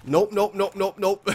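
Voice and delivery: high, loud panicked nopes. Real, not acted. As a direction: blurt nope in a panic. Wolf chasing him.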